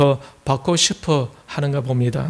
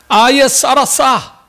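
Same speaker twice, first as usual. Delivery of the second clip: high, drawn-out mock yell in Gyeongsang dialect; exasperated Gyeongsang man; 9 semitones higher, 4 dB louder, much slower than usual. Gyeongsang man snapping at his girlfriend, loud Gyeongsang scorn. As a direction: explode in comic Gyeongsang exasperation. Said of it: "경상도 남자가 이렇게 이야기를".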